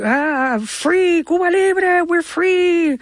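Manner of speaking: high, elated cheer in imitation; ecstatic. Imitating older passengers on the flight out of Cuba, ecstatic cry. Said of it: "and they're ecstatic".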